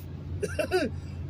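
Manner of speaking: high imitated giggle; squeaky kid laugh; childish giggle. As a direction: giggle like the little boy. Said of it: "in a fucking laugh".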